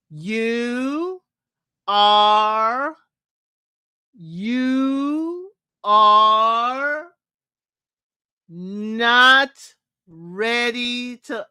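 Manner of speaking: drawn-out pauses, very slow, mock solemn; dramatic elimination speech. Recapping Steven's stalled breakup line, mock dramatic and slow. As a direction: stretch every word out like a stalling bachelor.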